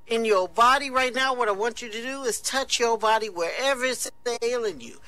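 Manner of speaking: high, loud, fervent preacher calling out; mock revival preacher at full force; radio evangelist. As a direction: whip up the listeners like a radio healer. Evangelist's radio call to touch.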